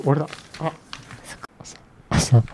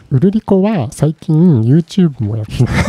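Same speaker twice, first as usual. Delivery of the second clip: slow deadpan plug; much slower than usual. Shown as a demonstration. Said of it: "あれを超素早くお願いします".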